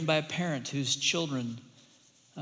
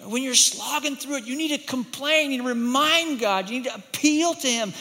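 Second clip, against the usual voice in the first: higher, urgent sermon push; fervent preaching; 7 semitones higher, 4 dB louder than usual. Acted. Preacher pressing listeners to lament, high and urgent. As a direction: lift the voice and drive it home.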